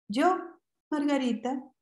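a hint of a shy small girl; little girl voice. A narrator's reading. Girl telling the boy her name, lightly childlike.

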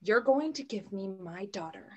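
usual speaking voice

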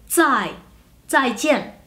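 "Zai" dropping in pitch, then "zaijian" with both syllables falling; falling-tone model. Shown as a demonstration. Teacher building up the word, "Zai" then "zaijian", each syllable falling. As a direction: begin "Zai" and "zaijian" with unaspirated dz. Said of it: "ese sonido".

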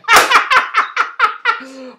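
high loud laugh; high bursting laugh. Real, not acted. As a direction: crack up at the punchline. Laughs at own joke.

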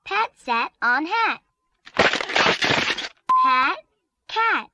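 usual speaking voice

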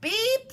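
high, loud, short beep; little truck beep. A narrator's reading. Blue calls his new friends, high beep. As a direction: toot it like a perky horn. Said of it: "said Blue".

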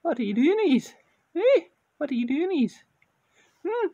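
put-on high, loud baby-talk to the cat; high pet-talk voice. Shown as a demonstration. Owner cooing high at his cat. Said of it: "see how he reacts when I start talking to him".

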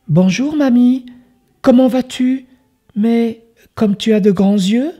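put-on a sweet child begging politely; little girl voice. Girl speaks to grandmother.

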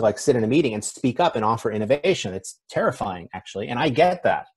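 ordinary talk

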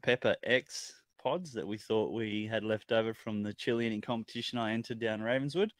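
ordinary talk

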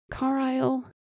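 high, pleading call of his name. A narrator's reading. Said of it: "Janier pleaded".

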